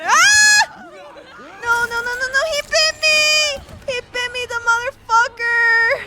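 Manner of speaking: loud high panicked yelling; panicked screaming. Real, not acted. Bitten by enemy in game.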